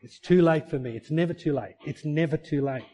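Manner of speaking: normal talking voice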